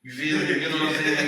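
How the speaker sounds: loud, high, rowdy Saint Louis talk. Retold or imitated. Still doing cousin Antoine for the hosts, loud Saint Louis voice. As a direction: lay on the Saint Louis slang. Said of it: "that's how he sound".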